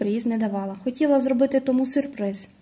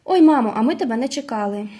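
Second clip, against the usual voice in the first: plain reading voice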